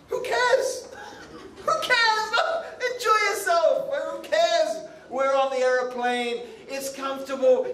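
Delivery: high-pitched, boisterous brush-off; carefree passenger. Acted. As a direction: laugh it off like a party-goer up high. Acting carefree passenger brushing off questioner.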